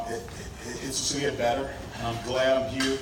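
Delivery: ordinary talk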